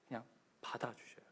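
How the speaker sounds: whispered gently; soft whisper. Real, not acted. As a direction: let it fall to a tender hush. God quietly accepts the people.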